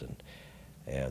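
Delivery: normal talking voice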